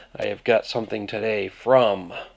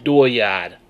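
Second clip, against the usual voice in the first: Maine "Dooryard" with an extra R after the vowel, AH ending, said louder; Maine accent; 7 dB louder than usual. Shown as a demonstration. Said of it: "add that R that doesn't belong".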